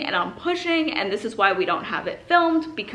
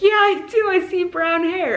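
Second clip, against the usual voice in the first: higher, louder happy reply; excited; 10 semitones higher, 7 dB louder than usual. Retold or imitated. Doctor telling the mother about hair, excited. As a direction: answer with delight.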